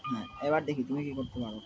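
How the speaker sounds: low, hushed hiding voice; whispering while hiding. Real, not acted. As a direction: speak low so you aren't found. Still hiding.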